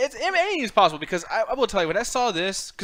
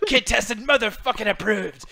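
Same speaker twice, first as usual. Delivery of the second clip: mock ad slogan; 4 semitones higher, slower than usual. Cereal ad parody.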